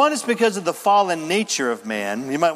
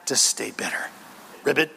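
ordinary delivery

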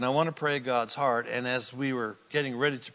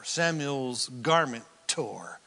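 ordinary talk, nothing marked

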